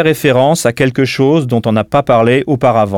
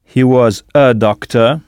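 slow repeat; slower than usual. Shown as a demonstration. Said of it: "model sentence repeated".

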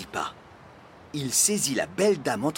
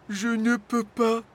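high-pitched sheepish admission; Flamèche confessing; 10 semitones higher than usual. A narrator's reading. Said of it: "avoua Flamèche". (aveu penaud, voix haute)

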